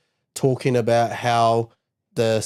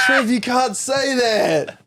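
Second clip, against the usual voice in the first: laughing protest; 12 semitones higher, 5 dB louder, much slower than usual. Real, not acted.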